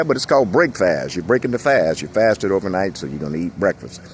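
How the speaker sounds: normal talking voice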